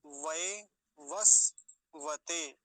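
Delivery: वैवस्वते very slowly, piece by piece; loud, drawn-out वैवस्वते with स left vowelless; half स. Shown as a demonstration. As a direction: break वैवस्वते into वै, वस्, वते. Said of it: "सकार हलंत है तो आधा ही रखेंगे".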